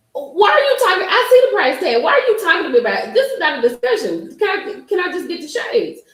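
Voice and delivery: high, loud, fast exasperated complaint; indignant retort. Retold or imitated. Arguing with husband over shades, high exasperated.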